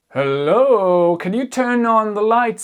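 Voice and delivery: loud, high call into the dark. Acted. Teacher calls out in the dark for someone to switch on the lights, loud and high.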